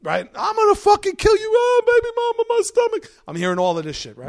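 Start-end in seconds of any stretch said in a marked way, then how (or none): 0.4-1.5 very high, loud threatening scream; Bill screaming a threat at his brother, very high; murderous yell. Retold or imitated. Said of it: "Bill threatening brother". 1.5-3.1 very high, loud moaning cry; hurt pregnant woman crying out, very high and loud; pained shriek. Retold or imitated. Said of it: "pregnant woman hurt".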